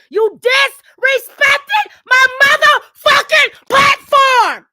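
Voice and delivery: high, loud outraged yell; enraged full-voiced tirade; furious shouting. Real, not acted. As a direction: yell it out, hammering every word. Real rant about being disrespected.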